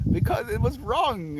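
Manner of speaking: high-pitched, overwrought protest; mock distressed whine. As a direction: imitate someone fretting, voice way up. Voices the worried person's distressed answer.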